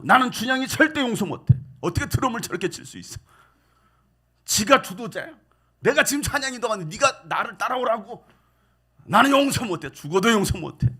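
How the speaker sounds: mock grudge rant. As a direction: vent like a bitter church member. Church member ranting about drummer.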